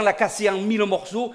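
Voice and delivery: normal talking voice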